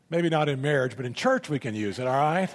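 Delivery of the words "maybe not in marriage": normal talking voice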